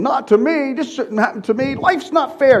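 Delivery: a hint of a whiny victim; mock whiner. Preacher mimicking self-pitying people, a light whine.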